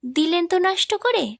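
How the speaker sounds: high, fond mock complaint; playful pouting girl. A narrator's reading. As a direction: tease him like a spoiled young girl. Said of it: "আদরে কাঁধ ঝাঁকালো মুন্নি".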